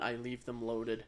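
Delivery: ordinary talk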